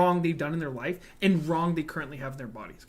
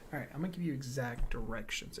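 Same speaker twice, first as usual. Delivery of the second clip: low, soft, lightly seductive delivery; mock sexy voice; 4 semitones lower, 8 dB quieter than usual. Imagining a co-host calmly dismissing a hater, mock sexy voice. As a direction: give it a hushed, smooth hint of seduction. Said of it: "do it in the sexiest voice".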